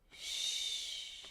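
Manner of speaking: unvoiced hushing shh held out; a soft steady shh on the breath; long shh fricative. Shown as a demonstration. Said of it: "we're just going to do these fricatives".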